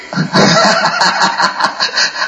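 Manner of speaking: long, high burst of laughter; a long run of chuckles; amused laugh. Real, not acted. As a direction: laugh at your own teasing joke. Laughs at his own teasing.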